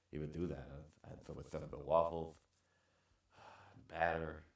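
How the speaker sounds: fading away. Real, not acted.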